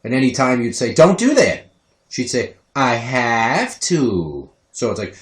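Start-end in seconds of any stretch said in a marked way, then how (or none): 0.9-1.7 higher, louder telling-off; raised-voice parent's warning; parental scolding. Retold or imitated. 2.7-4.5 put-on very slow, drawn-out toddler voice; eerie, dragged-out child's reply; creepy toddler. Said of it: "the way she talks sometimes".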